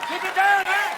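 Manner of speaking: high, loud Canadian yell; Canadian neighbor complaining. Acted. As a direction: holler up at the noisy party. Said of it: "They're like".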